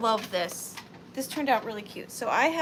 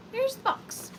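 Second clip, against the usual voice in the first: silly high voice; 11 semitones higher than usual. Pretend. Repeats in a high put-on voice.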